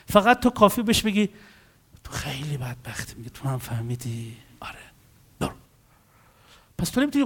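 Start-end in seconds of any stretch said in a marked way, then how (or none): 2.0-5.5 low, soft mock sympathy; hushed commiseration. Telling a victim they're wretched, hushed and pitying. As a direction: murmur it as sad confirmation.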